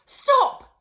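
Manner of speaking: high, loud cry. Acted. Missus Cranky crying out to her shrinking mother. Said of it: "cried Missus Cranky".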